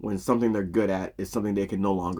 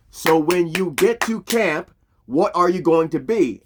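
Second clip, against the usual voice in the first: slow, raised, emphatic challenge; rallying coach; 6 semitones higher, 5 dB louder, slower than usual. Retold or imitated. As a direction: pump up the team with a question. Coach rallies kids with question.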